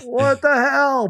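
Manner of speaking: high, whiny mock wail; mock anguish. Dad sees the rival jersey, in a high mock wail. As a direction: wail in hammed-up despair.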